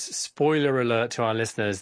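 normal talking voice

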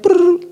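high brrr pouring sound; imitated cereal rattle; pouring noise.

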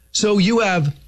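ordinary delivery

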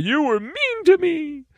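very high, whiny put-on victim; mock the self-pitying in a high cartoon voice; cartoonish victim voice. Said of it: "That's how they sound, too. They have this very weird voice. It's a cartoonish voice".

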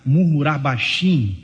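ordinary delivery

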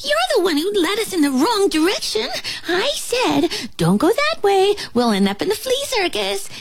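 high-pitched flea bickering; ranting flea. Acted. Flea replaying who led them wrong, ranting. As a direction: rattle off the old argument in a high voice.

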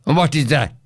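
usual speaking voice

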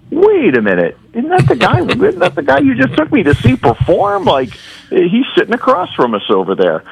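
high-pitched mock double take; surprised recognition. Fan spots the performer sitting across from them, surprised.

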